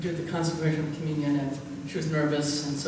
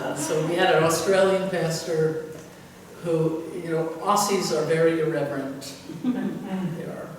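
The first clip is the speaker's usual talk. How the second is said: ordinary talk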